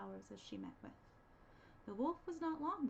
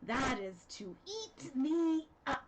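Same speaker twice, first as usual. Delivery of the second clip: loud, slow, menacing threat; menacing wolf; 4 semitones higher, 9 dB louder, much slower than usual. Acted. Wolf about to eat the girl, slow and loud. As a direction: drag it out before the pounce. Wolf's final line.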